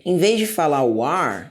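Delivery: ordinary talk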